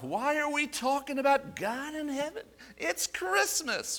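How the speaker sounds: high whiny complaint. Retold or imitated. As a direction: whine it high, dragging Christmas. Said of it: "I ask the question myself".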